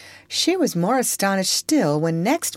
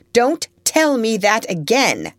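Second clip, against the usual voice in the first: louder, firm angry warning; 7 semitones higher, 5 dB louder than usual. Acted. Furious aunt shaking the lying girl, louder. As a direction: snap it as she shakes her. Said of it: "Miss Ophelia was so angry".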